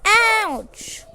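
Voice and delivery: high, loud drawn-out ouch; cry of pain. Real, not acted. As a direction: yelp it out loudly, high. Cries out while playing.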